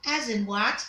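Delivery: normal talking voice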